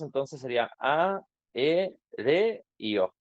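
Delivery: each syllable A, E, re, O said slowly and apart; split syllable by syllable. Shown as a demonstration. Teacher showing the class how aéreo would split without diptongos, slow and separated. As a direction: spell out A, E, re, y O one at a time, slowly. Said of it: "Si no existieran los diptongos".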